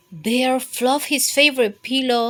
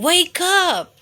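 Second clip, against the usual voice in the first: scared shout; 9 semitones higher, 6 dB louder than usual. Acted. Bear scared, wakes Mouse.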